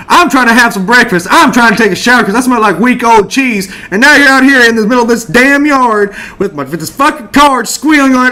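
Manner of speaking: high, loud, exasperated tirade; irate rant. Acted. Homeowner yells at film crew about breakfast, irate rant.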